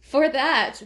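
normal talking voice

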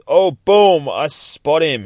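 loud, high burst of triumph; excited spotting. Retold or imitated. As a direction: burst out on spotting your target. Character suddenly spots wanted man.

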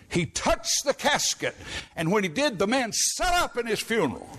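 high preacher's rise on "He touched the casket"; preaching build-up. Acted. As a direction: lift the voice as the dead man sits up.

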